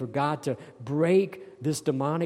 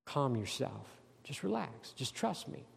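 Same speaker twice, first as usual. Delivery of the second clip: much softer, hushed and tender; soft reassuring; 10 dB quieter than usual. Shown as a demonstration. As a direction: soothe them almost in a hush. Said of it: "he says, "You know what?".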